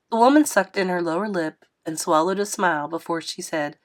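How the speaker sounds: ordinary delivery, nothing marked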